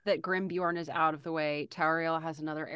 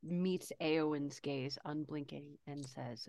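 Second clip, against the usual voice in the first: hushed narration; 2 semitones lower, 9 dB quieter than usual. A narrator's reading. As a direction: narrate softly under the breath. Hushed narration before Tariel line.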